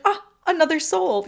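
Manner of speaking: light up at meeting another soul; excited and happy. Retold or imitated. Sick girl delighted to meet a visiting soul, excited. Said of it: "equally excited and happy to see me".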